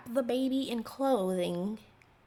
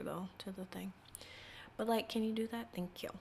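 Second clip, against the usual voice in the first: whisper; 9 dB quieter than usual. Real, not acted. Leaning close to the mic.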